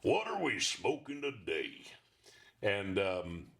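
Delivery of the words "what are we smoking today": slow cowboy drawl; lazy cowboy on a cigar podcast; slow Sam Elliott-style drawl. Acted. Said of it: "he talks like Sam Elliott".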